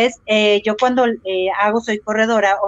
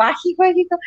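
quick, higher show of amazement; Mexican ah hijo; 9 semitones higher than usual. Shown as a demonstration. Showing listeners a Mexican expression of amazement, higher and quick. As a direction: toss off ah hijo as a surprised exclamation. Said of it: "Así decimos en México".

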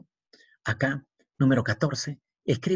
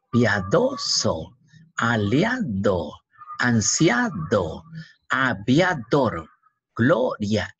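"Piadoso. Aliado. Ansiado. Aviador. Gloria." slowly, each broken into syllables; slow, emphatic syllable-by-syllable dictation keeping the diphthongs whole; syllable separation; 4 dB louder, much slower than usual. Shown as a demonstration. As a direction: beat out pia-do-so, a-lia-do, an-sia-do, a-via-dor, glo-ria. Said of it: "Eso se llama separación".

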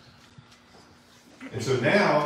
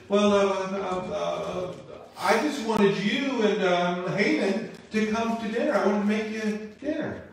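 nervous stammer, pitched up; chickening out; 8 semitones higher than usual. Retold or imitated. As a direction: stall with uhs, then back down sweetly. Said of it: "and she chickened out".

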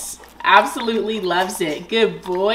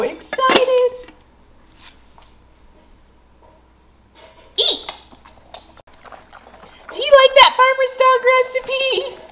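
excited dog talk. Real, not acted. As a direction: coo over the eager dog. Talking to her dogs at feeding.

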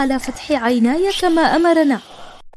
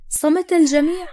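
ordinary delivery, nothing marked

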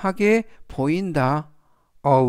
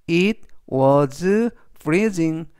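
very slow, deliberate example sentence; slow model reading; much slower than usual. Shown as a demonstration. Teacher reading the example aloud to students, slow and clear. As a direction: spell each word out for learners.

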